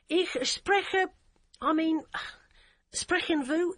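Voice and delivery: flustered. Acted. Fumbling for German on the phone.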